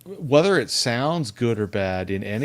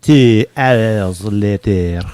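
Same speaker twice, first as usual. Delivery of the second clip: slow, louder time-card announcement; SpongeBob narrator; 6 dB louder, slower than usual. Retold or imitated. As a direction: boom it like a cartoon title card. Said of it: "the narrator of SpongeBob".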